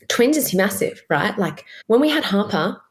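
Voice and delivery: normal talking voice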